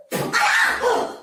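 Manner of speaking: loud, very high mock-shocked cry; startled yelp. Storyteller slapped by their own hand, startled yelp.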